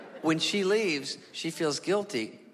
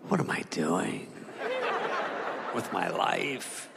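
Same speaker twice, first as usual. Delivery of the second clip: mope aloud with long pauses; brooding dog; 6 semitones lower than usual. Retold or imitated. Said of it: "sort of thinking out loud".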